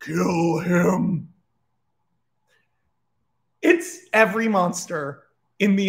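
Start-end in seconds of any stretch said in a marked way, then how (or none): 0.0-1.3 drawn-out demonic command; slow, menacing devil voice; big devil voice. Acted. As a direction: order it as a huge Satan, stretching each word. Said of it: "The big devil goes".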